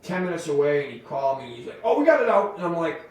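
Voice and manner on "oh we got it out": high, louder casual announcement; breezy news pitched high; high, loud mock of the caller; relieved caller. Caller phones back relieved.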